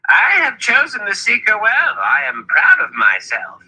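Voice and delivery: very high, gleeful boast easing down; grinning self-congratulation. A narrator's reading. Zed congratulating himself on his choice, gleeful pride. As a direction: crow proudly through a broad grin. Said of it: "He broke into a broad grin".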